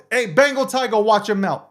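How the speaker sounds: ordinary delivery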